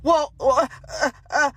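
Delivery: a light, half-hearted stammer; mock stutter. Mocking Karen caught without an answer, stammering lightly. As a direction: stumble over the uh sounds lightly. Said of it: "and just stutter, stutter, stammer".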